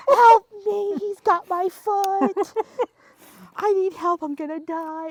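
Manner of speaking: high, loud desperate plea, slowing down; mock panicked cry. Caregiver screaming for help as the parents walk in. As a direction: beg for help as if being dragged.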